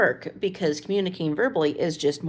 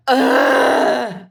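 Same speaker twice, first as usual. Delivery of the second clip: loud, high exasperated "ugh" thrown back; mimicked groan; 7 semitones higher, 10 dB louder than usual. Mom mimicking her son's groan back at him. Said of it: "when I just mimicked him perfectly".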